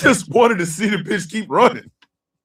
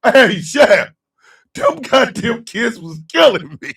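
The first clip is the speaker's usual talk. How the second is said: normal talking voice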